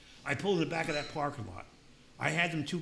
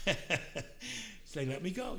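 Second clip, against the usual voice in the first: laughing; 8 semitones higher, much slower than usual. Real, not acted. Laughing at getting away.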